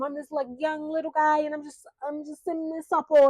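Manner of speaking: lightly put-on high little-guy voice; mock little guy. Mocking a forty-year-old playing young, mock little guy. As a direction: pitch it up to mock his claim.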